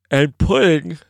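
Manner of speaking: words stretched by a yawn. Real, not acted. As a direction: say it mid-yawn. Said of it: "sorry, a little yawn there".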